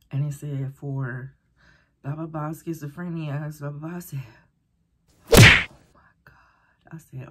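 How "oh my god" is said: slow breathy gasp of shock. Real, not acted. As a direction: gasp it, horrified. Reading schizophrenia result, shocked.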